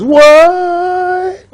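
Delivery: high, long drawn-out "whaaat"; amazed king. Acted. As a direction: stretch it out high in mock astonishment. King amazed at straw turned gold.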